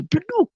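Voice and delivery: high-pitched spoken slam sound; sudden vocal thump of something thrown down; imitated thud. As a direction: voice the crash. Imitates cross slammed down.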